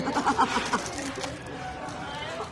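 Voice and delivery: soft extended laugh; laughter. Real, not acted. Host joking about staff leaving, soft laughter. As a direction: laugh on quietly for a moment.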